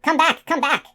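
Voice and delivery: high, loud desperate shouts; shouting. A narrator's reading. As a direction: yell after them, begging them back. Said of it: "they shouted".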